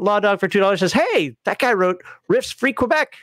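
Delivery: ordinary delivery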